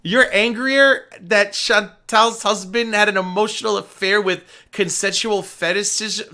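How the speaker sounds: ordinary talk, nothing marked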